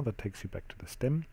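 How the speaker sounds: ordinary talk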